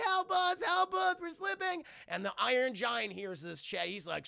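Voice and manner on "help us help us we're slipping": high, loud squealed plea; panicked kids. Retold or imitated. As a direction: imitate children crying for help. Kids in danger crying out.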